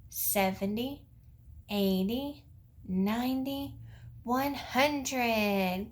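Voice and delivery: put-on slow, spaced counting for kids; teaching a child to count. Shown as a demonstration. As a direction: count each number slowly for little ones. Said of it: "count out loud with me".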